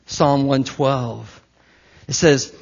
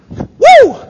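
high, loud sudden whoop; excited whoop; 12 dB louder than usual. Real, not acted. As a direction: let out a joyful yelp mid-sentence. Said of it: "it almost got me".

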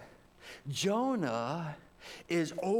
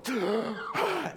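mock gasp; 5 semitones higher than usual. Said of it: "and I go".

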